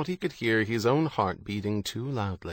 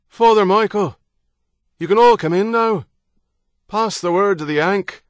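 calling out; 10 semitones higher, 9 dB louder than usual. Acted. Hands cupped, calling afar.

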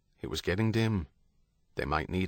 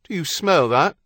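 raised British docker's call; British dock worker; 6 semitones higher, 6 dB louder than usual. A narrator's reading. Someone outside smelling vomit, loud British docker. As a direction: ask loudly in a docker's British accent. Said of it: "someone outside asked".

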